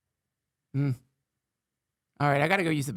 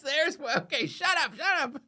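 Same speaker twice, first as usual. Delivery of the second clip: laughing; 11 semitones higher than usual. Real, not acted. Breaks up laughing at the AI.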